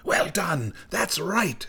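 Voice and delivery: high witchy cry of approval; high, exclaiming storm-witch praise; old snow woman crying out. A narrator's reading. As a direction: cheer them on in a high hag voice. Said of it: "cried the old snow woman".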